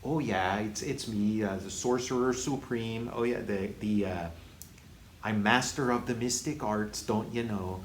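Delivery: mock Fargo accent; Benedict Cumberbatch. Critic mocking Doctor Strange introducing himself, exaggerated Fargo accent. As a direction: put on a folksy Minnesota accent like Fargo. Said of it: "he does sort of the Francis McDermott, uh, accent".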